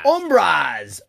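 brand name called out loud and long; hammed-up ad shout of the name; mock announcer. Co-host finishes the sponsor plug, mock announcer.

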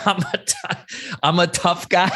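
high, laughing echo; amused disbelief. Real, not acted. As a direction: laugh while repeating the label. Laughs at being called tough guy.

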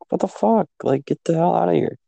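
high squeaky mock outrage; high-pitched imitation of Angela yelling at him. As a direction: squeal it like she's shocked. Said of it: "And she's like".

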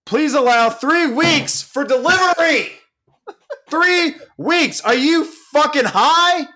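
high-pitched incredulous rant; worked-up shrieky outrage; outraged screaming. Real, not acted. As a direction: screech it in disbelief. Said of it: "Madeline just texted me that I'm screaming".

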